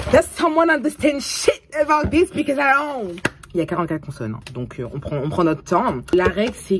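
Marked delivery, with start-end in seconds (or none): 0.1-3.2 high, loud cry of bafflement; exasperated outburst. Real, not acted. Student lost in Thai tone rules, exasperated outburst. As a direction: shout it out high, completely fed up.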